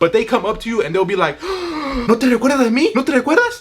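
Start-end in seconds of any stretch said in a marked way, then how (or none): 1.5-2.0 a sharp hammed-up gasp; mock gasp. Relative feigning shock at seeing him again, hammed-up gasp. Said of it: "Acting all surprised". 2.1-3.6 mock surprised. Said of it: "Acting all surprised".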